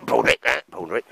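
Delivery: loud call across the camp; calling the dog. Real, not acted. Calling Baldrick to fetch the stick, loud. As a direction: shout the dog's name, rising.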